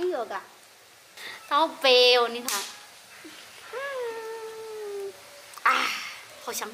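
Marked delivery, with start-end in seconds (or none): none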